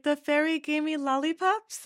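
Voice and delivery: put-on excited toddler. Mom imitating her daughter thrilled about the fairy's lollipops, high childlike voice. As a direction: mimic a small child bragging about a gift.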